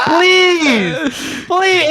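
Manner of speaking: mock pleading. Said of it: "I'm like".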